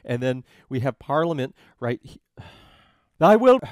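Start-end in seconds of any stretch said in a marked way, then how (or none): 3.2-3.6 loud, high proclamation, broken off; booming judge. Acted. Judge starting to address Parliament, booming. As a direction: boom the opening words like a judge.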